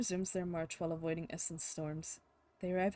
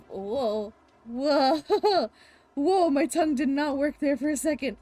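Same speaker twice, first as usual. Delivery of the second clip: loud startled whoa, much higher; startled shout; 6 semitones higher, 12 dB louder, slower than usual. Acted. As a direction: shout out in surprise, then laugh. Startled by a sudden storm.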